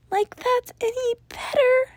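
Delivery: high, sharp hissed jab; hissed retort. A narrator's reading. Sam hissing at Danny, high and sharp. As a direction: hiss it at him. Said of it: "Sam hissed".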